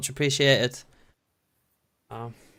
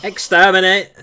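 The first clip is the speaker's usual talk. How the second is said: Dalek voice; 3 semitones higher, 7 dB louder than usual. Pretend. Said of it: "That's what you say".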